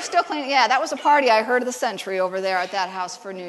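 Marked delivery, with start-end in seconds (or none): none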